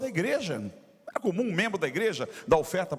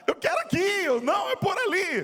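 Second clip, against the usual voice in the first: very high, excited re-enacted shout; urgent calling; 4 dB louder than usual. Father keeping son away from cookies, frantic mock calling. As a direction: act out a frantic tug-of-war of directions.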